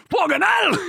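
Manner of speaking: high, frantic Geordie yelling; Geordie shout. Acted. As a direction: yell urgent orders in broad Geordie. Geordie yelling as bomb explodes.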